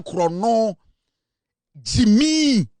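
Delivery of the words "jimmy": higher, louder drawn-out cry; mocking exclamation. Real, not acted. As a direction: call it out high and loud, stretching it. Rant mocking opponents as stupid.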